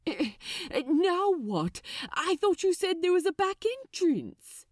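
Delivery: a fretful angel asking what now; fussy angel voice. Acted. Angel to Crowley on the deck, lightly voiced anxious.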